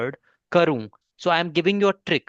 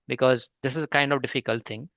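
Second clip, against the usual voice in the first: ordinary talk, nothing marked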